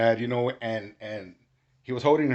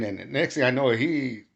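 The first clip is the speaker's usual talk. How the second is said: ordinary talk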